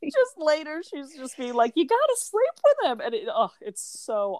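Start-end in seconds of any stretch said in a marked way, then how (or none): 1.8-3.0 pushy advice. Retold or imitated. Acted quote of pushy advice.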